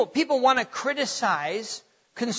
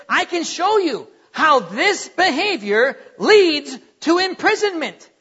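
raised, loud retelling of a scolding; emphatic parental warning; 5 semitones higher, 6 dB louder than usual. Retold or imitated. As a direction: build insistently to the final phrase. Retold stern warning to his children.

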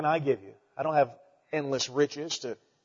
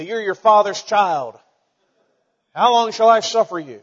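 mock exasperated parent; 5 semitones higher, 8 dB louder than usual. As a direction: scold the kid half-joking. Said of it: "You say".

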